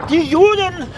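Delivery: high, loud startled outburst; startled shout. Acted. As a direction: jolt awake and blurt it. Waking with a start.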